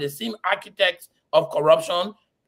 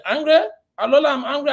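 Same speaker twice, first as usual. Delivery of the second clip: louder, higher, incredulous comeback; indignant, raised voice; indignant retort; 6 semitones higher, 5 dB louder, faster than usual. Real, not acted. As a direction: fire back the word angry in disbelief.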